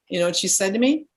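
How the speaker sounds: ordinary talk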